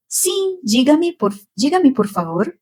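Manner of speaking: lightly voiced courteous receptionist; his polite phone voice. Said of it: "com aquela voz dele".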